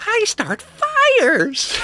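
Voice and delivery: put-on very high, slow creepy kid voice; a twitchy kid confessing in a squeaky whisper-thin pitch; squeaky high fidgety kid, slow. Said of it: "fidgety kid".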